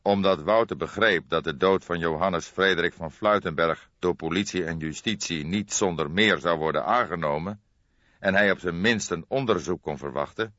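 usual speaking voice

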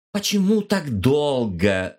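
slow cross demand; angry exclaim. Acted. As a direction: scold him for taking so long. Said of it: "рассерженно воскликнула Мия".

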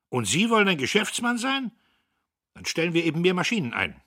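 put-on young boss shouts down the cautious clerk; furious shout. A narrator's reading. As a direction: blast him with scorn. Dieterich shouts at Södbier.

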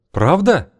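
high, startled question; surprised. A narrator's reading. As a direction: disbelief turning to hope. Husband disbelieving joy.

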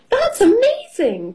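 very high, excited exclamation; amazed cry. A narrator's reading. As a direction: exclaim in high-pitched astonishment. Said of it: "cries Miss Rabbit".

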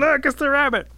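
high, loud cry of discovery; screaming. A narrator's reading. Crowd sees the disguise fall off, screaming. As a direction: scream out the unmasking. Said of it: "everybody starts screaming".

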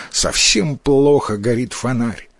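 ordinary delivery

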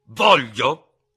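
explosive, high shout on one word; sudden yell. A narrator's reading. Ferraud erupts at his fellow lieutenant, yelling suddenly. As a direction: burst out yelling. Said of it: "urlò all'improvviso il tenente Ferraud".

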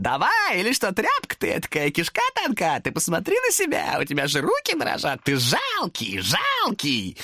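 high mocking demon voice, jeering; taunting demon. Demon jeers at trembling Thomas. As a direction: sneer at him from way up high.

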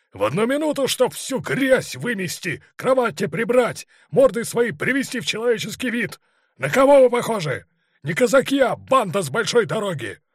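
furious high, loud barked orders; boundless rage. A narrator's reading. Heated colonel running round the guardroom, raging shout. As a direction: tear into them at the top of your voice. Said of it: "Его ярости не было границ".

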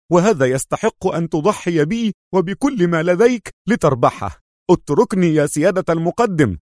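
high, impassioned insistence; stubborn. A narrator's reading. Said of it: "استطرد في عناد".

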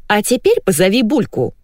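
high-pitched command; commanding wife. A narrator's reading. Wife demanding to hear the dog, commanding. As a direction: give an order as the high-voiced wife. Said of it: "приказала Валентина".

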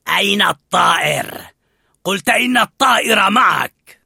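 loud, angry dog's bark of a question; the pack leader demanding the bird; furious, loud growl of a voice; angry shout. A narrator's reading. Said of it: "تقدم ألفا نحو داغ وقال بغضب".

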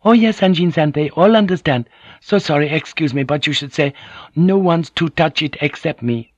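high, bright-voiced polite wife; polite Japanese wife. A narrator's reading. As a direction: correct him sweetly and humbly. Fujiko correcting him politely.

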